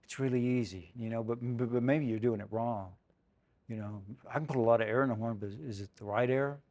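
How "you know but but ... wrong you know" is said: ordinary talk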